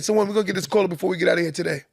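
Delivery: ordinary talk, nothing marked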